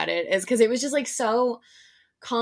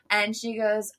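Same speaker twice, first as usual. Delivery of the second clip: ordinary delivery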